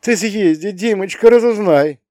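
thin, high-pitched feeble pleading; weak voice. A narrator's reading. Mother urges her departing son to find out, weak voice. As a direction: send him off in a weak, high voice. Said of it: "слабым голосом вслед ему сказала".

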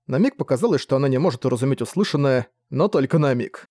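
plain reading voice

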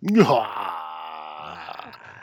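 loud laugh breaking out; real burst of laughter. Real, not acted. Cohost cracks up at the joke, loud laughter. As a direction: laugh hard at the punchline.

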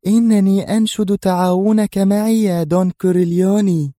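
high-pitched, flirtatious female voice; coquettish. A narrator's reading. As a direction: coo the request coquettishly, voice lifted high. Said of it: "وهي تقول في دلال".

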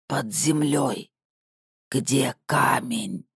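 slow, rasping puppet voice; creaky doll voice. Acted. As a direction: rasp it like a broken speaker. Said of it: "прохрипел репродуктор".

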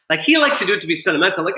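ordinary delivery

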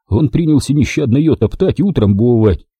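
plain reading voice

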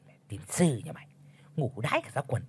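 whispered swearing under the breath; muttered cursing. Acted. The chief mutters curses at old Chánh behind his back, whispered. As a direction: curse him where he can't hear. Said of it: "lẩm bẩm chửi rủa".

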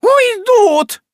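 very high, loud wailing shriek; вопль. Acted. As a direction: howl the word over the banister. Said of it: "заголосила миссис Раддль". (хозяйка голосит через перила, очень высоко)

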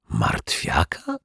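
breathless, slow whispered question; hushed, unvoiced asking. A narrator's reading. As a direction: whisper the name in disbelief.

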